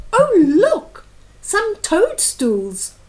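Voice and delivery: high, loud thrilled wizard cry; excited cry. Acted. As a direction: call out in delight at the toadstools. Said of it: "and cried".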